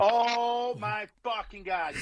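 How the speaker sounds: high, drawn-out oh my God; stunned recognition; real astonished recognition. Real, not acted. Reacting to the story prompt.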